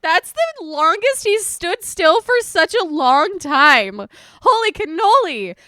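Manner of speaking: loud, slow astonished exclaim; amazed. Real, not acted. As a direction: marvel aloud at the cat. Amazed at cat.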